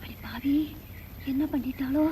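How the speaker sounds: a breathless, horrified whisper; hushed dismay as the burning starts; shocked whisper. Acted. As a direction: whisper it in horror. Mother gasps at burning pain.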